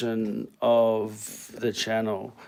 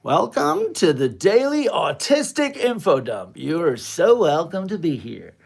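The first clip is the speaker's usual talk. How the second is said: announcer intro; 5 semitones higher, 4 dB louder than usual. Pretend. Livestream host intro, announcer voice.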